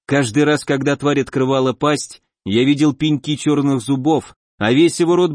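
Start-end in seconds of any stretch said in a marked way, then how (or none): none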